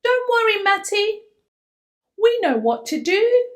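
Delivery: high, loud cheerful call; friends shouting. Acted. Luke and Grace shouting from across the room, loud and high. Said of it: "shout Luke and Grace".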